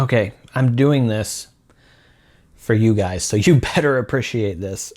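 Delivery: normal talking voice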